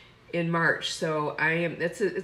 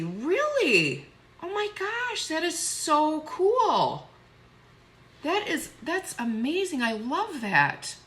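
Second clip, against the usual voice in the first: high, astonished gush with drawn-out words; delighted amazement; 9 semitones higher, much slower than usual. Real, not acted. Streamer learning of a viewer's daughter's charity, delighted amazement. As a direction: gush in real amazement, pitch leaping.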